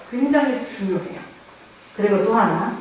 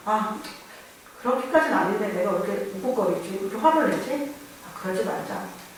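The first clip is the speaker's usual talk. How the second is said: softer and softer, fading into a whisper by the end; quiet self-talk; 9 dB quieter than usual. Acted. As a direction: talk yourself down under your breath until it's only a whisper. Self-talk calming one's anger. (혼잣말처럼 점점 작아지다 속삭임으로)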